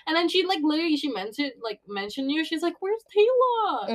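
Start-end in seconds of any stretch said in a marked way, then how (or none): 2.8-3.9 high, drawn-out motherly question; imitated concerned mom. Mom asking after the friend, imitated high concern. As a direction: ask slowly in a pitched-up mom voice.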